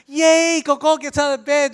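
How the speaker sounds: put-on high, eager kid's cheer; enthusiastic child. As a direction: bounce out of bed with glee. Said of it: "very enthusiastically".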